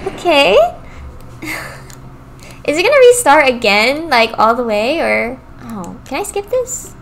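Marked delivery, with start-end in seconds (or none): 0.6-1.8 breathy laugh. Real, not acted. Laughs after dying.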